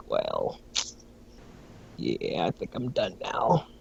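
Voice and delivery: quiet, slow, resigned sigh of a line. Retold or imitated. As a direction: let it drop, done with it all. Giving up on the game.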